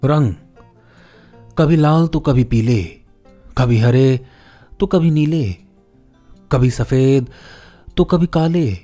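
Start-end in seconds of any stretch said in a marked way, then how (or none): none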